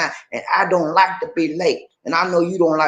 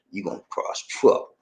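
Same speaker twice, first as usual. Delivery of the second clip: ordinary delivery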